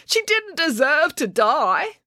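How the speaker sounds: put-on high, slow, plaintive boy voice; mournful boy. A narrator's reading. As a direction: lament it as the son.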